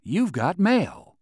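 slow robotic "You've got mail"; mechanical voice. Acted. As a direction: sound like an automated mail alert. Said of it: "the mechanical voice said".

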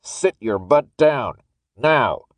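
slow, emphatic order from the guard; angry, drawn-out demand; stern command. Acted. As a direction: order him down, spacing it out. Armed guard cuts Thomas off.

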